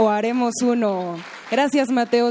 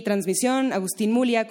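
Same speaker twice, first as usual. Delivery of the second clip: ordinary talk, nothing marked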